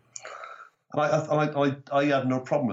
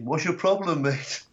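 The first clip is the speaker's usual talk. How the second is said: retold shout; 7 semitones higher, slower than usual. Heckler's boyfriend calling over to the comic, mild mock shout. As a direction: imitate a heckler calling out a challenge. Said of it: "he shouted over something to me".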